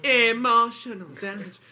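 put-on slow, drawn-out comic catchphrase accent; put-on accent, stretch out each word; Stephen Hugh. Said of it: "Stephen Hugh!".

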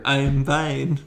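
flat robotic mock line; deadpan wooden delivery; bad acting. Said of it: "the worst acting ever".

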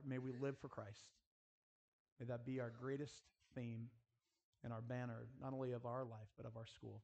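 usual speaking voice

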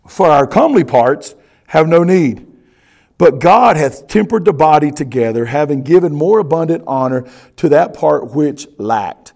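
loud, projected Bible reading; forceful scripture reading. Acted. Pastor reading the next verse to his church, declaimed loudly.

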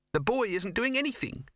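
high, tiny mouse voice; squeaky mouse voice. Acted.

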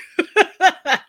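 short, high, loud laugh; laughing at herself mid-argument. Real, not acted. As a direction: laugh at your own point. Laughing at own line.

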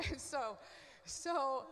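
breaks into a real laugh. Real, not acted. Laughing at her own blunt joke about rich people. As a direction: laugh it off, then carry on.

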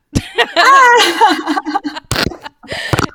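high, loud, long laugh; loud burst of laughter. Real, not acted. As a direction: crack up at the punchline. Punchline of the bus story lands.

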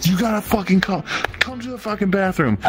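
high-pitched mock yell of a furious mom; screaming mom. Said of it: "screaming at him".

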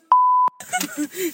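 high piercing laugh; squealing laugh. Real, not acted. Laughs at own joke.

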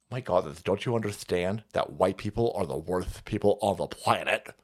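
low, soft mocking impression; hushed, low put-on scolding; mock lecturing activist. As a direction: drop low and quiet to mock the complainer. Mocking imitation of newspaper staff.

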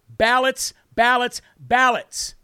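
"ballots" said slowly three times, each one separately stressed; emphatic repetition. Real, not acted. Host repeating the key word for viewers, slow emphatic repetition. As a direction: drive home "ballots", leaving space after each. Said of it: "Why am I emphasizing that word".

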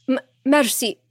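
high, stuttering plea; begging. A narrator's reading. Cornered king pleading with the heroine, begging. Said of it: "he begged".

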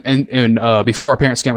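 ordinary delivery

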